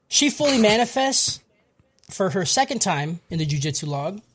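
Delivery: ordinary talk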